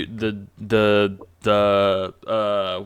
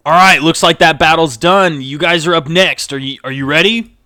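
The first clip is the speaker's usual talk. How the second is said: loud, raised call to the players; hyped stage organiser; 6 semitones higher, 9 dB louder than usual. Acted. Stagehand telling the group they are next, loud and hyped. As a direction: call the next act up with energy. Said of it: "He's like".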